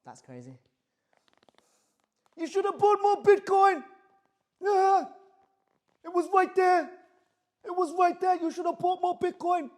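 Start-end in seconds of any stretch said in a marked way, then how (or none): 2.4-9.7 very high, loud mock New Jersey voice; a loud high New Jersey nagging bit; New Jersey accent. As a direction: nag loudly in a cartoonish New Jersey accent. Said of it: "I don't know why I have a New Jersey accent".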